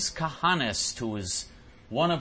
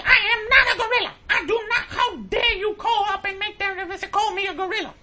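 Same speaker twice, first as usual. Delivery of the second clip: much higher, louder offended voice; mock indignant supervisor; 7 dB louder than usual. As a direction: ham up her outrage.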